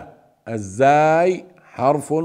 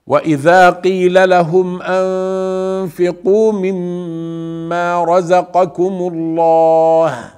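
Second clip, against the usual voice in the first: melodic tajweed chant; Quranic recitation; 4 semitones higher, 3 dB louder than usual. Shown as a demonstration. Teacher reciting the verse to students, melodic chant. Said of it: "teacher reciting verse".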